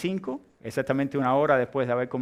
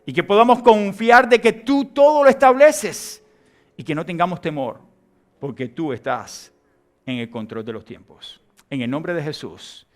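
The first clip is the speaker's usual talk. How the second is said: oración lenta y sentida, con énfasis creciente; oración final; 3 semitones higher, 5 dB louder than usual. Real, not acted. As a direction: ora pausado, sube con fuerza y baja hacia el amén. Leads closing prayer. (oración ferviente y pausada)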